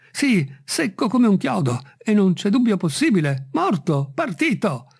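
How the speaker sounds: high-pitched servant, insistent; emphatic Jupiter. A narrator's reading. Servant confirming to his master the branch is dead, emphatic. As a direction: insist in short, high bursts.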